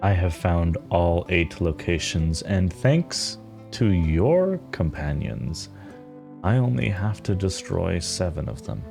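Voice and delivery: solemn mother figure. Acted. Game master as a determined mother to her child, solemn and measured. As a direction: confide a life's purpose with deliberate pauses.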